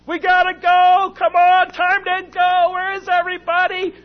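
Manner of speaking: high, frantic shouting to hurry everyone; frantic yelling. Retold or imitated. Dad yelling at the family to hurry out the door, frantic. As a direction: hurry the whole family out in a panic. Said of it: "is a basket case".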